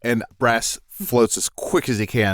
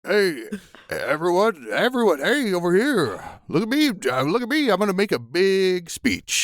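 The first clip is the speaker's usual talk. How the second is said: Kemp's voice thrown; 7 semitones higher than usual. Acted. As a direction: call everyone over as the corpse. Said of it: "throwing my voice being you".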